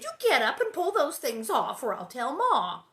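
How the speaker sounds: big sister threatening to tell; stern older sister; scolding. A narrator's reading. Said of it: "Mary said".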